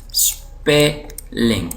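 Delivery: اسپیلنگ split slowly into spe-ling; slow word demonstration. Shown as a demonstration. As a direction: draw out اسپیلنگ in two separate syllables. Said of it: "آج کا ہمارا دوسرا topic ہے". (اسپیلنگ کو دو ٹکڑوں میں آہستہ پڑھنا)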